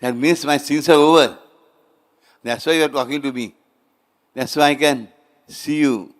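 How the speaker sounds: a devotee bursting with joy; joyful relief. Retold or imitated. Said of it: "He opened his eyes and said".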